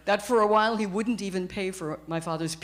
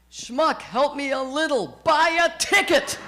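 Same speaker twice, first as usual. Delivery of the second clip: exasperated booming voice of God; 6 semitones higher, 4 dB louder than usual. Retold or imitated. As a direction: scold him from the heavens, louder at the end. God's voice in the joke.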